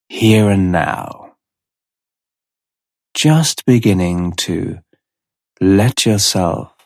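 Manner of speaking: slow, measured hypnotherapist voice with long pauses; unhurried guided-trance delivery; hypnotic relaxation induction. Real, not acted. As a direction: ease the listener down into relaxation, a few words at a time.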